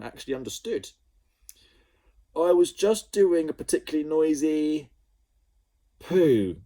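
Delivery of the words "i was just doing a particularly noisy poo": high, loud spluttered excuse; spluttering Ned. A narrator's reading. Ned spluttering through the bathroom door, flustered and loud. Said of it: "spluttered Ned through the bathroom door".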